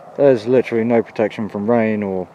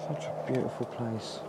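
nervous night vlog whisper; 11 dB quieter than usual. Real, not acted. Uneasy in forest.

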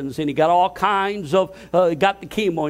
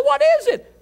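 a much higher, louder startled cry; startled shout; 4 dB louder than usual. Retold or imitated. Pastor retelling his reply to God, a startled high shout. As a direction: jump at the question, shouting.

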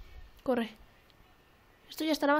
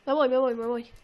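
ordinary talk

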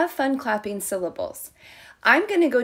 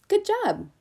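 ordinary talk, nothing marked

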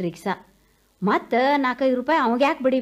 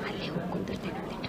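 fast, softer unvoiced aside to herself; whispered aside; 5 semitones lower, 12 dB quieter, faster than usual. Weary old woman decides to go sit there, whispered fast to herself. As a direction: whisper it quickly under the breath.

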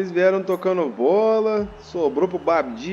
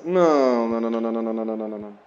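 despairing no; 7 semitones lower, much faster than usual. Real, not acted. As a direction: let the nos die away. Chance slipping away.

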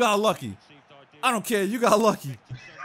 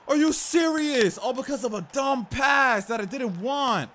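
outraged; 6 semitones higher than usual. Real, not acted. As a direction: vent at the bad pass. Outrage after conceding.

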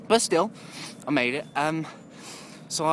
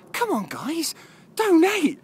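high, loud mock exasperated shout; hammed-up angry call for donations; mock angry plea; 7 dB louder, slower than usual. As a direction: yell at the donors in playful frustration. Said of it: "I got a little bit angry".

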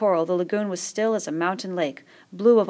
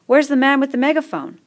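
high, louder worried question; anxious call; 8 semitones higher, 7 dB louder than usual. A narrator's reading. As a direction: call out hoping for a grown-up. Fat boy calling out anxiously.

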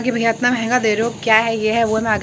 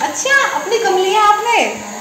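high, slow teasing surprise; mock surprise; 9 semitones higher, slower than usual. Real, not acted. Daughter teases her mother over the smaller glass, in high mock surprise. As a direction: tease mama about her smaller tea.

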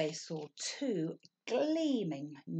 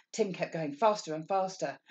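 plain reading voice

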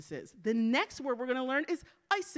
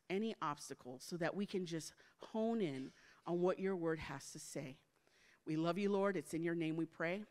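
hushed, lower praying voice; prayer; 4 semitones lower, 6 dB quieter than usual. Real, not acted. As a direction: lead the room in soft, earnest prayer. Leads a prayer.

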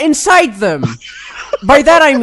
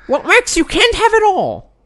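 put-on higher, croaky old gremlin; 8 semitones higher than usual. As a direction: talk like a wizened little gremlin. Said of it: "you sound like an old gremlin now".

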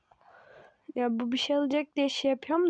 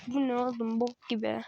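usual speaking voice

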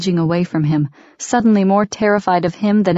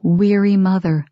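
plain reading voice

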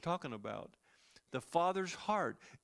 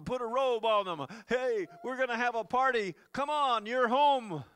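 rising, louder celebratory calls; jubilant; 6 semitones higher, 7 dB louder than usual. Acted. Father calling servants for a party, excited. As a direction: build the excitement to the homecoming.

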